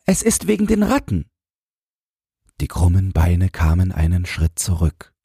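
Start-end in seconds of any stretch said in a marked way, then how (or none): 0.1-1.3 put-on very high, fast child's voice; hasty boy. A narrator's reading. Boy confides about the rats, hasty. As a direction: blurt out the secret quickly. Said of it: "sagte Jürgen da schnell".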